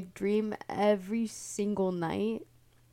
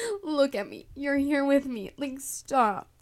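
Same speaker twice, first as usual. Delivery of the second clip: higher, louder insistence; mock urgent plea; 6 semitones higher, 7 dB louder than usual. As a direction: firmly talk her out of it. Urging Snooki to stop.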